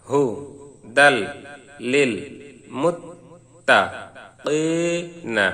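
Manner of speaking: broken-up syllables, each one set apart by a pause; slow separated syllable drill. Shown as a demonstration. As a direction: say each syllable alone, then stop. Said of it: "শুরুতে আসুন ভাঙ্গা ভাঙ্গা উচ্চারণ".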